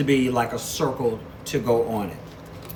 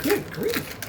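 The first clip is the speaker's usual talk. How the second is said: half-laughing high good grief; exasperated exclamation. Real, not acted. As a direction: exclaim at the stubborn packaging, pitched very high. Opening the tight package.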